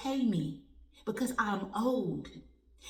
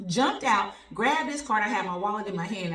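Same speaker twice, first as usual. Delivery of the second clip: ordinary talk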